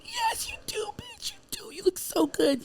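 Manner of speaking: high, breathy whisper, egging her on; high whispered hype from a friend; whispered cheer. Retold or imitated. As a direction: hiss the praise up high like a sly friend.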